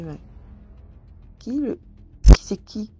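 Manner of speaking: sudden loud, startled outburst; shocked question. Retold or imitated. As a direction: blurt it out in disbelief. She is shocked at the accusation. (question choquée, soudain plus forte)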